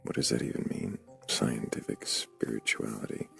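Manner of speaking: ordinary talk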